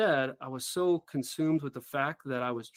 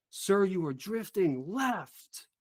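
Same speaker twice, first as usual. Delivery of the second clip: higher, slow, pressing callout; urgent retold shout; 6 semitones higher, much slower than usual. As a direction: escalate the warning, each word spaced out. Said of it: "escalation in the urgency and in the volume that was being shouted".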